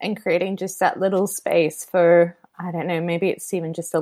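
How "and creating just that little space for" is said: normal talking voice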